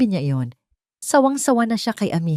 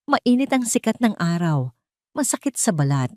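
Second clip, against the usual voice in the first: usual speaking voice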